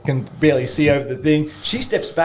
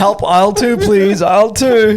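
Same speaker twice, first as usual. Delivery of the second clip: loud, high store-announcement plea; mock distress call; 8 semitones higher, 6 dB louder than usual. Joking as a cashier hitting the panic button, mock alarm. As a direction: drag out the last "aisle two" like a hammed-up tannoy call.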